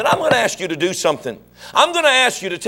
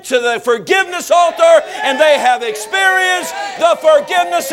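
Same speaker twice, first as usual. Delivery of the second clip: full-force preaching; 9 semitones higher than usual. Acted. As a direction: hammer it home from the pulpit.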